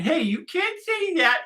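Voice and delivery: high, slow sing-song tattletale voice; mock kindergarten scold. Mocking grown-ups as kindergartners, high tattletale scold. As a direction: whine it like a kid telling on a classmate.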